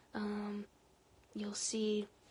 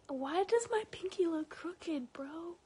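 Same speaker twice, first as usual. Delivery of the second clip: high-pitched, incredulous question; sudden squeal of bafflement; baffled outburst; 7 semitones higher, 5 dB louder than usual. Real, not acted. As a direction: blurt it out high, in disbelief. Baffled outburst at own crooked pinky.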